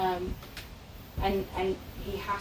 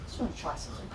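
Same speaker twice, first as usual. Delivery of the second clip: whispered thought; 3 semitones lower, 9 dB quieter than usual. Retold or imitated. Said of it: "I thought".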